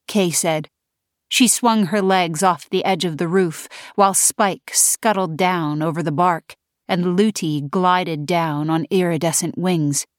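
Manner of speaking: ordinary delivery, nothing marked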